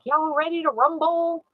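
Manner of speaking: a hint of a boxing ring announcer, high and slow; mock announcer. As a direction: playfully draw it out like a fight call. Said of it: "It's almost like".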